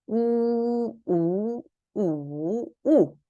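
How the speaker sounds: 우 level, rising, dipping, then falling; four-tone drill. Shown as a demonstration. Teacher letting students hear four tones on 우, level, rising, dipping, falling. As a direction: chant 우 through high level, rise, dip, drop. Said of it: "성조 사 성을 붙여서 한번 읽어볼게요".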